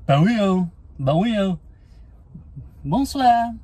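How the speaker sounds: high, sing-song French Bonjour; mock French greeting. Listing greetings in every language, in mock French. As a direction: trill it out like a caricature Frenchman.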